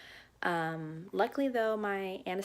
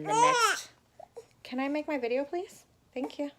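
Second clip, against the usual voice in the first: pleading; 10 semitones higher, 7 dB louder than usual. Real, not acted. Asks child to let her film.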